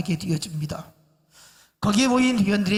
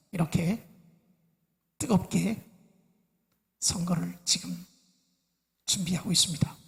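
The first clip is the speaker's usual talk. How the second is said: breathy stage whisper; 5 dB quieter than usual. Acted. Preacher hushing the congregation about the heated race. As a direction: let the line fall into a conspiratorial whisper. Dramatic hush about the election.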